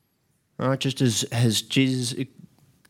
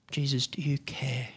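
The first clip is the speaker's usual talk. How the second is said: whisper; 7 dB quieter than usual. Retold or imitated. Hurting believer's quiet prayer.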